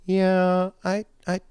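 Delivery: high, hesitant, stammering; drunk lady voice. Retold or imitated. Imitating the drunk lady stumbling over going home. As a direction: mimic her wavering decision to leave. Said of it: "she's like".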